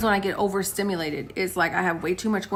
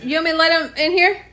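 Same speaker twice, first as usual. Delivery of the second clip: higher-pitched question called to someone off; real called-out check, pitched up; calling out; 7 semitones higher, 3 dB louder than usual. Real, not acted. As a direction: repeat the question across the house, lifting at the end.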